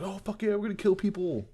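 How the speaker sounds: high-pitched imitation of a bloodthirsty crew; mock eager cheer. Pirate crew cheering, retold.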